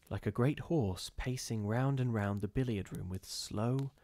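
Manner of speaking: plain reading voice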